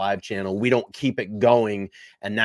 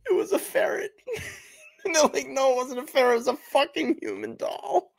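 squeaked out much higher through crying laughter; helpless laughter. Real, not acted. Cohost retelling the host's reaction, helpless laughter. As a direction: try to retell it but keep breaking up.

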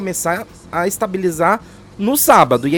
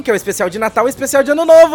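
excited; 6 semitones higher, 6 dB louder, faster than usual. Real, not acted. Excited holiday special announcement.

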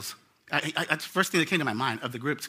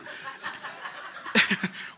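short airy laugh; breathy laugh; 5 semitones higher, 3 dB quieter than usual. Real, not acted. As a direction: let out a quick breathy laugh. Laughs at own joke.

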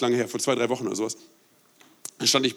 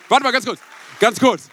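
higher, louder, cutting himself off excitedly; excited interruption; 8 semitones higher, 6 dB louder than usual. Real, not acted. Preacher halting his own story to the church: excited, higher, louder. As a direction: stop the story, too moved to go on.

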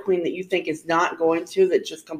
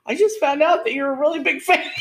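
quoting [name]; 9 semitones higher than usual. Retold or imitated. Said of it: "she's like".

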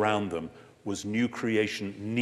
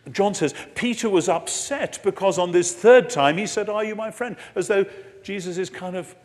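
excited preaching; 9 semitones higher, 7 dB louder than usual. Real, not acted.

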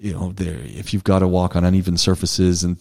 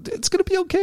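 mock whiny reassurance; 3 dB quieter, faster than usual. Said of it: "and I'm going".

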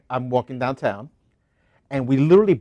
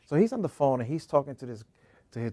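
ordinary talk, nothing marked

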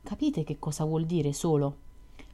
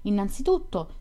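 ordinary delivery

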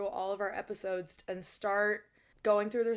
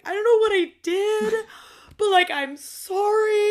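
profuse apology; 11 semitones higher, 9 dB louder than usual. Pretend. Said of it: "I would, like, be profusely apologizing".

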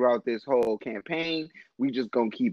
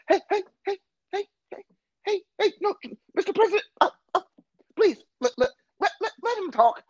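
much higher, pleading hey-hey-hey; a flustered moderator begging for order; frantic moderator; faster than usual. Retold or imitated. Moderator Chris Wallace pleading with the President, high and frantic. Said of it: "Chris Wallace just like".

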